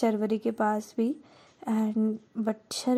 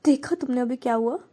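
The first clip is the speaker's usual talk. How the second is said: higher, voice breaking into tears; crying confession; 6 semitones higher than usual. Acted. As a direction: sob it out, guilty and overwhelmed. Girl breaks down confessing guilt. (रोते हुए टूटकर बोलना)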